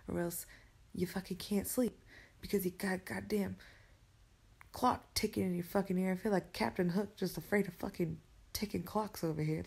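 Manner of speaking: normal talking voice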